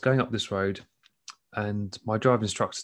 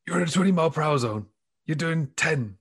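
higher, urgent northern instructor; put-on Sheffield voice, pitched up; Sheffield accent; 6 semitones higher, 3 dB louder than usual. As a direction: scold a learner in a Sheffield accent. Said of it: "he was a northerner, he was from Sheffield".

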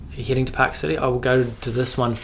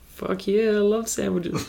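hammed-up eager yes, higher pitch; mock keen reply; 8 semitones higher, 3 dB quieter than usual. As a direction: play the cheeky customer, overdoing the enthusiasm. Mock reply to cashier's question.

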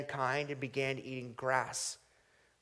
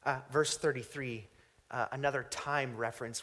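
ordinary delivery

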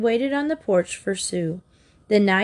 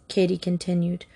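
usual speaking voice

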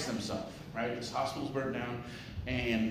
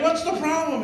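chicken voice; 9 dB louder than usual. Acted. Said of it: "the chicken's like".

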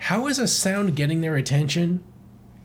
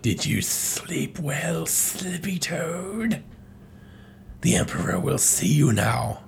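slow, drawn-out villain voice, softer; sinister chameleon; 2 semitones higher, 4 dB quieter, slower than usual. Acted. Chameleon greets chained Slippy, slow sinister villain voice. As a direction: stretch the words out like a creepy captor.

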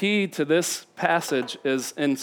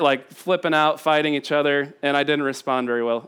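ordinary delivery

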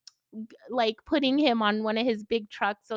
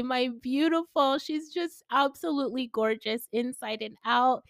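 ordinary talk, nothing marked

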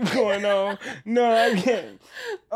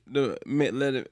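ordinary talk, nothing marked

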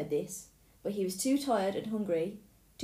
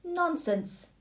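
dismissive wife; 9 semitones higher than usual. Acted. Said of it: "said the giant's wife".

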